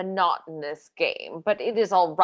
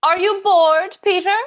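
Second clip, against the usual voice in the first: higher, louder call to wake him; 11 semitones higher, 9 dB louder than usual. Acted. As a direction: raise the voice to rouse him. Calling to dozing old man.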